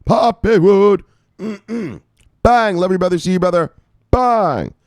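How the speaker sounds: high and loud, bursting with hype; excited shout-out. Real, not acted. Host hyping a viewer with bang and love you.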